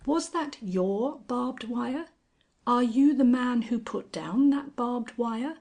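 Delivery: dawning outrage at the man; incredulous. Acted. Angel's astonished indignation.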